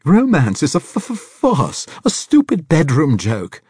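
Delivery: very high, loud stammering passion; impassioned outburst. A narrator's reading. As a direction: pour it out breathlessly.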